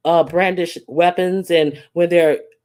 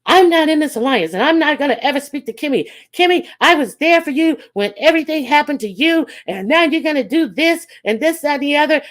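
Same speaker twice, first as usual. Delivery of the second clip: high, loud, fast angry rant; burning mad; 9 semitones higher, 3 dB louder, faster than usual. Retold or imitated. Tracy ranting live at Kimmy, furious high rant. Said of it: "she's burning mad".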